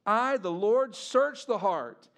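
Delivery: preaching. Acted.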